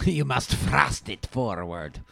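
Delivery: exaggerated, drawn-out pronouncement; hammy put-on voice. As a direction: declaim it slowly in a silly voice. Joking put-on voice on wordplay.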